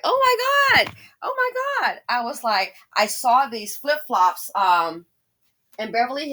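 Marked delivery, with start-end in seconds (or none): 0.0-2.0 much higher shocked oh my God; shocked squeal. Retold or imitated. Teen self seeing pricey flip-flops, high shocked squeal. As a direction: squeal it like a stunned teenager. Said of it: "I was shocked".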